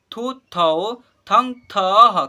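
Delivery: slow, loud, drawn-out syllable recitation; syllable drill. Shown as a demonstration. Teacher leads class in reciting barahkhadi, slow and loud. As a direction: call out each syllable clearly for the class to repeat. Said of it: "उच्चारण कर रहा हूँ".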